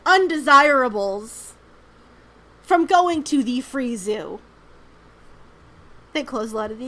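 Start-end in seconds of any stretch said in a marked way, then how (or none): 0.1-1.5 "undesirables" slow and louder, in mocking quotes; air quotes. Shown as a demonstration. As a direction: lean on "undesirables" as a sneering quote. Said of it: "I'm using quotes again".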